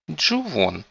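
sharp high call of a name; displeased girl. A narrator's reading. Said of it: "недовольный женский голос".

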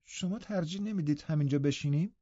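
high, soft reluctant suggestion; half-hearted plea in a lifted voice. A narrator's reading. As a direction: ask softly, unwilling to budge. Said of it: "با لحنی که بیمیلیش را نشان میداد".

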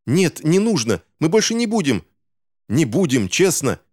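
put-on high-pitched frantic child's begging; pleading. A narrator's reading. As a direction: beg desperately to be spared. Caught child begs villain for mercy. (отчаянная высокая мольба)

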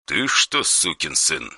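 soft, cold menace; quiet threatening caller on the phone; dry harsh voice. Acted. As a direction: threaten without raising the voice. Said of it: "сухой, нетерпящий препирательств голос".

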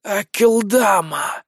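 slow, drawn-out hissing name; snake voice. Acted. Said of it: "представился гад".

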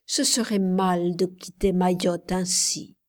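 ordinary delivery

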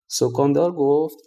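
ordinary delivery

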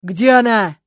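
loud, sharp shout; yell. A narrator's reading. Stas yells at Lyuska on the phone, loud. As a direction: bellow the question. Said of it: "заорал он".

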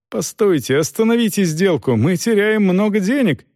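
high, alarmed appeal; urgent pleading. A narrator's reading. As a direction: beg him urgently to stop. Captain pleading to stop deal.

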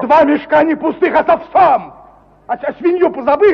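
heated insistence. Acted. Heated quarrel over the gun.

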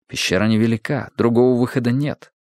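ordinary delivery, nothing marked

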